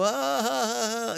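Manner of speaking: high, drawn-out mock "ah"; imitated reaction noise. Mimicking what y'all makes New Yorkers go, mock high "ah". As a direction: imitate a thrown-off crowd with high, stretched ahs. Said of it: "the thing that makes you go".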